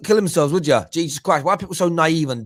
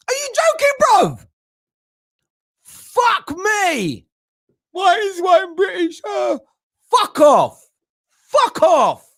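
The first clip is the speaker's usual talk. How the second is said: furious rant; slower than usual. Real, not acted. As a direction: yell it in exasperated outrage.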